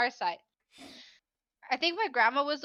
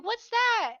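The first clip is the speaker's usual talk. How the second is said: higher-pitched mimicked kids; children asking; 9 semitones higher, 3 dB louder than usual. Teacher retells pupils spotting fallen squirrel, lightly voiced children. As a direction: pipe up as a curious child. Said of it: "And the children are like".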